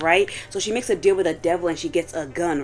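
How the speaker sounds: ordinary talk, nothing marked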